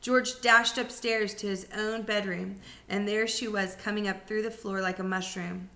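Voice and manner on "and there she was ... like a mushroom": usual speaking voice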